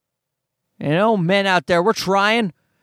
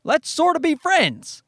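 mock friendly approach; 10 semitones higher than usual. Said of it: "and being like".

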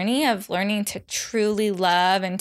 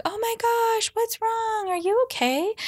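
much higher, squeaky worried partner voice; exaggerated Scott voice. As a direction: play her partner as a silly high-pitched worrier, much higher. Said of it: "he doesn't actually sound like that".

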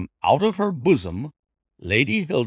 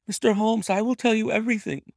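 high, fast eager cry; lady crying out to Holmes, higher and fast; cried out; 11 semitones higher, much faster than usual. A narrator's reading. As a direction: blurt out the promise. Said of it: "cried the lady".

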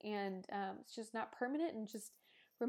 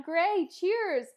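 cheerful toast; 9 semitones higher, 10 dB louder than usual. Real, not acted. Toasting baby daughter with wine.

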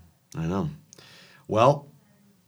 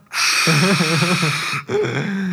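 burst of laughter; 9 semitones higher, 6 dB louder than usual. Real, not acted. Laughing at the cough interruption.

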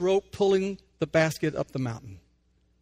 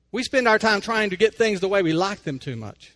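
usual speaking voice

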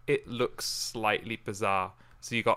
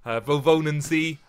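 schoolbook German; 4 semitones higher, 6 dB louder than usual. Pretend. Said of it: "I remember my German".

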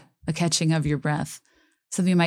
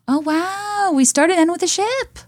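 high, bright sudden realization; delighted surprise; 13 semitones higher, 4 dB louder than usual. Real, not acted. Fan realizing the album starts and ends with ships, surprised. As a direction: gasp at the discovery. Noticing the ship callback.

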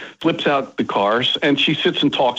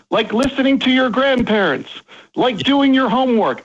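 yelling out; 12 semitones higher than usual. Retold or imitated. Dad yells advice at daughters walking away, playful yell. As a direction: holler it after them down the block. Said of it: "yell out".

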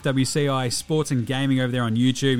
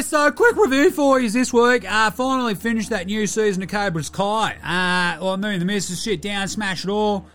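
a cheeky Aussie larrikin with a higher voice; bogan character Delhi; 4 semitones higher than usual. Acted. Delhi does his pop culture review, cheeky larrikin voice. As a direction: play him like a mouthy mate down the pub.

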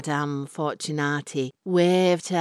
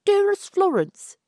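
high, louder devoted suitor voice; lovestruck young man; 6 dB louder than usual. Acted. Albert greets his beloved Florence, high and adoring. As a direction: gush it like a smitten young man.

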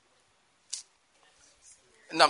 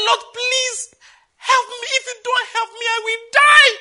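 high, loud pleading cry; desperate prayer; 11 dB louder, slower than usual. Shown as a demonstration. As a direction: beg God as if dying. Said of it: "Get on your knees and say".

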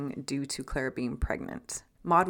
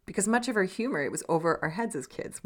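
usual speaking voice

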